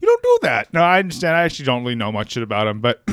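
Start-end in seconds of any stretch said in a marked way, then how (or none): 0.0-0.7 high mock Peterson voice, louder on the no's; Jordan Peterson impression. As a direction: wave off the suggestion in the parody voice. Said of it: "he says".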